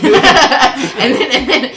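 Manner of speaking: laughing through the words; words spilling out in laughter. Real, not acted. Laughing hard mid-story.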